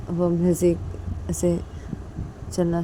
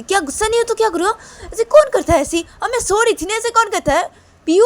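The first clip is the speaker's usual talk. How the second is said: much higher, louder, fast complaint; indignant outburst; 9 dB louder, faster than usual. Acted. Mother tells Piyu why she is angry, indignant. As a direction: vent that you were asleep. Mother justifies her anger.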